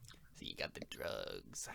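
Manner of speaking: hushed, conspiratorial whisper. Acted. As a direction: whisper it like a secret deal. Quiet aside about the drugs.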